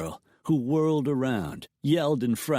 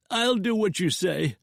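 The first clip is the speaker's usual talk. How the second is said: higher, frightened surrender; cowed giving in; 10 semitones higher than usual. A narrator's reading. Banker yielding to the outlaws, cowed and high. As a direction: give in fearfully. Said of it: "the banker pleaded, thoroughly cowed".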